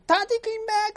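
very high, loud squeal of joy; excited child's shout. Acted. Children shrieking as their father walks in, very high and loud. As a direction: shriek it like a thrilled kid.

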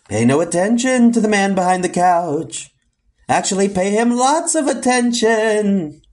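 high, swelling spooky proclamation. Acted. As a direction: intone it like a hidden phantom. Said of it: "not in that spooky voice".

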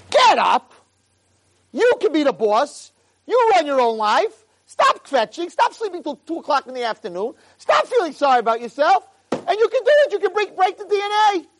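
high, loud rousing shout; rousing command. Acted. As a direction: shout him out of bed, rallying. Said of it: "Hashem is saying".

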